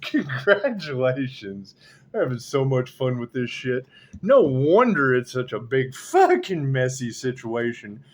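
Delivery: ordinary talk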